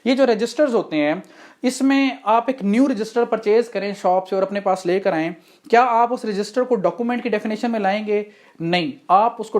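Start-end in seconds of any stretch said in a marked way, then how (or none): none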